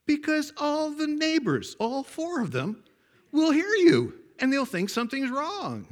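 high, slow, sustained calling voice; mother hollering. Retold or imitated. Mother warning son about the neighbors, hollering. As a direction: holler the reason across the distance.

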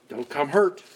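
normal talking voice

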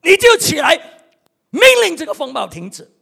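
rally the congregation to rise up; preaching shout. Real, not acted. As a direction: shout it high and loud as a command. Preacher urges commanding the storm. (高声命令般呼喊)

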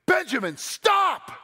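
put-on a dad yelling in panic at his toddler; panicked scream. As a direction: scream his name to stop him dead. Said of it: "and I screamed".

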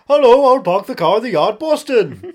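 put-on loud, high Boston accent; hammed-up Boston accent, called out.

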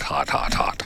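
hot, hot, hot fades to a whisper; trailing whisper. Pretend. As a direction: let each hot fade out breathless. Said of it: "The Florida version of this is".

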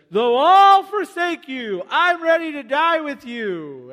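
loud, high and slow, swearing loyalty; bold vow. Acted. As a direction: proclaim it slowly at the top of your voice. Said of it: "Peter said".

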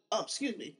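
soft, throat clearing apology. Real, not acted. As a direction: clear your throat, then excuse yourself. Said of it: "water went down the wrong tube".

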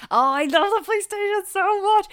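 high, loud, delighted burst; gushing. Real, not acted. Film fan gushing to a friend about a set, delighted. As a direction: swoon over a favourite film set.

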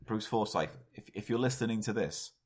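normal talking voice

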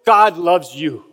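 slow, earnest repeated plea; Billy Graham pleading. Retold or imitated. Preacher tells the vast audience, slow and earnest. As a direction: press the words home, slowly. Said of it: "he would say".

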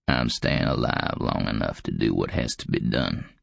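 put-on low, grim old man's voice; deep, aged growl; old frail growl. A narrator's reading. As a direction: growl it low with stubborn resolve. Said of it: "even his growl sounded old and frail".

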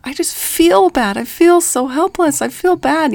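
mock helpless lament. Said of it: "a lot of people were saying that".

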